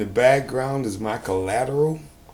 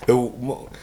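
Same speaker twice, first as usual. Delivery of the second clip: ordinary delivery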